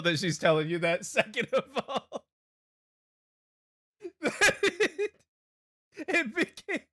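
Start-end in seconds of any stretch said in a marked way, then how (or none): 1.0-6.9 high laughing break, voice cracking up; squealing with laughter mid-sentence. Real, not acted.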